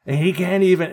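high, loud imitation of a ranter; retold yelling. Imitating the drunk guy ranting about Biden, mock yelling. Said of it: "So he's yelling about".

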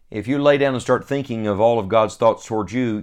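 ordinary delivery

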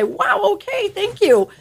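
high, startled gasp of thanks; a stranger caught off guard by kindness; voicing a stranger stunned by a compliment; shocked thanks. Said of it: "just shocked turn around to you and say".

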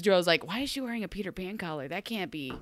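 ordinary talk, nothing marked

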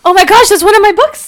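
high, loud excited outburst; real thrilled squeal of recognition; delighted surprise. Real, not acted. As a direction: burst out on discovering a shared favorite. Discovers a shared favorite book.

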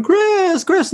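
high, drawn-out call of his name; imagined crowd cheer. Retold or imitated. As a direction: sing it out like adoring fans.

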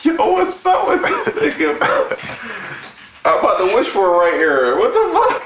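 high laughing squeal; cracking up mid-sentence; laughing hard. Real, not acted. Laughing hard at Kells mixup.

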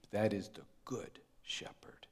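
breathy hushed whisper of awe. Real, not acted. Preacher whispers the key line to the congregation. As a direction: drop to a whisper to land the point.

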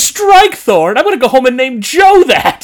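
high, loud, outraged quote; a mock meltdown over a name; freaking out. Mock-quoting Katie wigging out.

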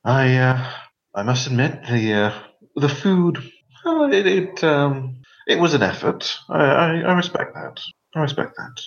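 put-on strained politeness in a cut glass English accent. Noblewoman praising the food in cut glass English accent. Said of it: "she says in this very cut glass, uh, accent".

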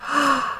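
quick soft gasp of surprise; hushed shocked intake; startled gasp. Acted. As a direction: catch your breath at the ugly chick. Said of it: "stared at it and exclaimed".

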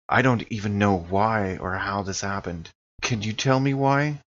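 ordinary talk

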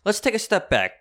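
lecturing neighbor lady. Retold or imitated. Lady starts lecturing him about cat.

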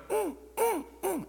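high little puppy whimper; squeaky mock whimper of a dog; imitated dog whimper. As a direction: whine like a nervous puppy. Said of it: "when he go".

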